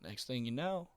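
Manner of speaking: ordinary talk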